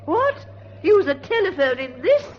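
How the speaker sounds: high, loud cry of disbelief; appalled shock. Acted. Aunt horrified that niece will use the phone, shrill outcry. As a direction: shriek it out in horror.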